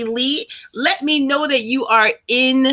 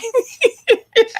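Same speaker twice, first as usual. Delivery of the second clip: high laugh. Real, not acted. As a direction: let a real laugh break out. Laughing at own April Fool remark.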